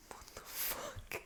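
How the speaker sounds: breathy disbelieving whisper, drawn out slowly; whispered aside. Real, not acted. Whispering in disbelief at a coworker's dream car. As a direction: whisper it under your breath in shock.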